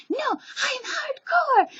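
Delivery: very high, loud, drawn-out mock protest; cute protester. Vlogger imitating cute Japanese friend, high squeaky protest. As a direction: squeal it like someone adorably insisting he's tough. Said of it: "and he's like".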